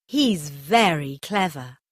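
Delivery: usual speaking voice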